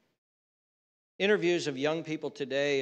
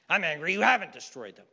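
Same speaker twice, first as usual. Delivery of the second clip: quick, louder mock outburst; imitated anger; 5 dB louder, faster than usual. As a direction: snap back at God as the angry prophet. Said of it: "he's angry about that situation".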